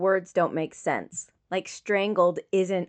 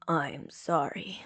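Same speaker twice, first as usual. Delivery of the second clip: whisper; 4 semitones lower, 8 dB quieter, slower than usual. Acted. Zuko hurt, apologizing weakly.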